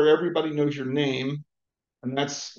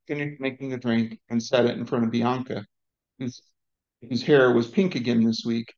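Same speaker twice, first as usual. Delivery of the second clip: usual speaking voice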